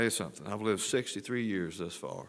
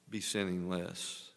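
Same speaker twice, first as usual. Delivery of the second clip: normal talking voice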